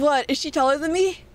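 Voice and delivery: ordinary delivery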